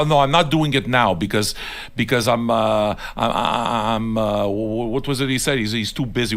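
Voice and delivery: imitating someone fumbling an excuse; stammering excuse. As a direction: stumble over a retold excuse, grasping for words. Mimicking a man's stammered excuse.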